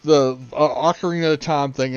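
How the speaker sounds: usual speaking voice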